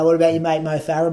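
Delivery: ordinary talk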